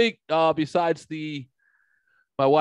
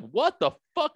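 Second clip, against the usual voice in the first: high incredulous outburst; retold stunned swear, pitched high; shocked exclamation; 14 semitones higher than usual. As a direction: blurt it out, stunned. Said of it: "I'm like".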